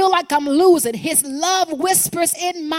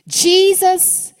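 proclaimed name; 5 semitones higher, 5 dB louder than usual. Real, not acted. Preacher proclaiming the answer.

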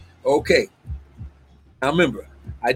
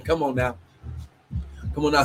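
ordinary talk

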